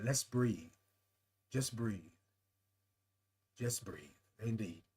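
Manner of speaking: very soft, low breathing cue; soothing, settling almost to a murmur; calming. Real, not acted. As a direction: hush right down and guide them to breathe. Leads everyone in breathing calmly.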